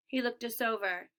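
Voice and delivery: ordinary delivery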